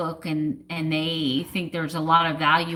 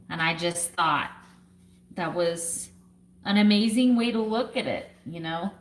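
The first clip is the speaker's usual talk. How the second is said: ordinary delivery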